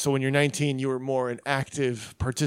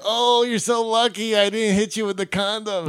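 raised-pitch imitation of a roommate; mock taunt; 7 semitones higher, 3 dB louder than usual. Roommate to man just woken, mock taunting. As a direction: needle him in a higher voice.

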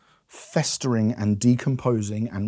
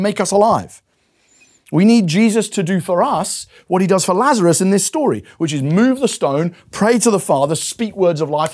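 higher, louder, driving urgency; impassioned preaching; 7 semitones higher, 6 dB louder than usual. Real, not acted. Preacher urging the congregation they need grace, impassioned. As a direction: preach it with rising fervour.

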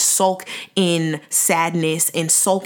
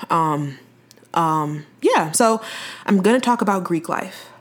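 ordinary delivery

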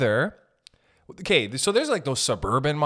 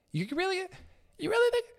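mock incredulous; 6 dB quieter, much faster than usual. Real, not acted. Mocking haters, pitch leaps.

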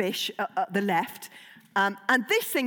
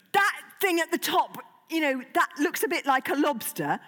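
normal talking voice

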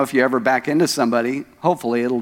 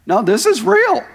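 much higher and louder; excited insistence; 13 semitones higher, 5 dB louder than usual. Real, not acted. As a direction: burst out to insist it's true. Insisting amid congregation laughter.

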